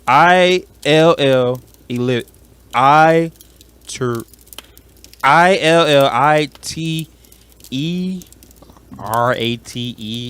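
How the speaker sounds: slow, letter by letter with pauses; tentative spelling attempt. Real, not acted. Trying to spell illiterate after the challenge, slow and tentative. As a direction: sound it out haltingly, stretching each letter. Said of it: "Okay, I'm gonna try".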